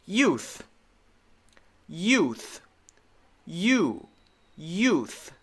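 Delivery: "youth" with a gliding y into a long oo; teacher modelling "youth". Shown as a demonstration. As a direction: say "youth" slowly, y then ooh. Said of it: "this is the long U sound with the Y".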